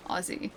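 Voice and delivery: "Aussie" with a voiced Z in the middle, quietly; soft "Aussie", the double S voiced as Z. Shown as a demonstration. Streamer teaches the viewer "Aussie", said with a Z sound. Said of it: "That's how you say it".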